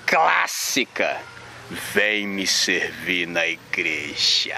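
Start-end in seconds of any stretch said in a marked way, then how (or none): 1.7-4.6 the voice of God, deep and drawn out; Cid Moreira voice. Retold or imitated. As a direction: intone it slowly like a grave TV news anchor. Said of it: "com a voz do Cid".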